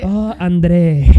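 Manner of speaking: slow, dreamy mock swoon; wistful remembering. Host imitating a listener recalling a lover, dreamy swoon. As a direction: swoon slowly over the remembered name. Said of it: "Te salió un nombre así".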